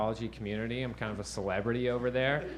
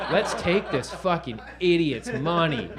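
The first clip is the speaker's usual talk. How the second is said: greedy gloating; 4 semitones higher, 4 dB louder, slower than usual. Retold or imitated. Scammers sizing up a mark.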